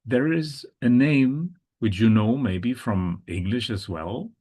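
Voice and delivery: ordinary talk, nothing marked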